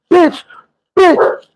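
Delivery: high, abrupt exclamations; punched-out insult. Retold or imitated. Sister hitting the girl with uppercuts, punched-out insult. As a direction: spit each word like a landing blow, pitched high.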